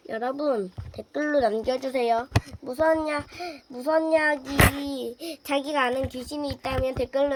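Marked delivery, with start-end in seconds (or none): none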